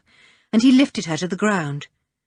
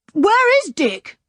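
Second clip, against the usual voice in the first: demanding; 13 semitones higher, 4 dB louder, slower than usual. Acted. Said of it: "demanded Julian".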